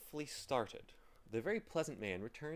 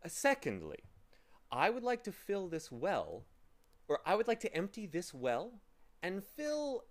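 higher, louder storybook gentleman; pleasant man, emphatic; 4 semitones higher, 4 dB louder than usual. Acted. Pleasant man giving Tock the second task, politely emphatic. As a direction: spell out the chore with polite insistence.

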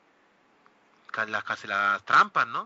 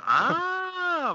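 mock surprise.

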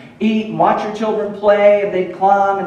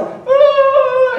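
put-on child's wail; 6 dB louder than usual. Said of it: "he starts crying".

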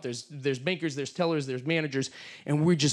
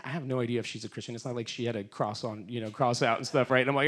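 ordinary talk

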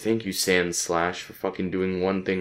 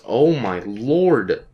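long drawn-out, louder exclamation; exasperated outcry; 3 semitones higher, 5 dB louder, much slower than usual. Real, not acted. Player reacting to a game attack, drawn-out dismay. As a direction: stretch every word in disbelief.

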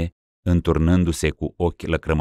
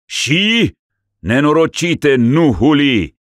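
loud, slow, high reproach; stern rebuke; 10 semitones higher, 6 dB louder, slower than usual. Acted. Metropolitan rebuking the blaspheming ruler. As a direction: cut him off sternly. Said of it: "îl curmă mitropolitul".

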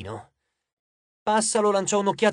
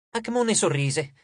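plain reading voice

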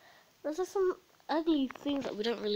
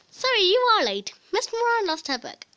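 loud sign voice; 10 semitones higher, 8 dB louder than usual. Pretend. Sign voice repeated loudly.

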